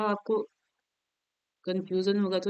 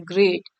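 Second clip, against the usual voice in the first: Great as one single beat; 5 semitones lower, 4 dB louder than usual. Shown as a demonstration. Teacher showing what one syllable sounds like, said in one go. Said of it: "यानी एक शब्द जैसा लगे".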